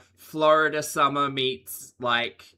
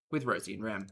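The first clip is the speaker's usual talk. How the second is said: normal talking voice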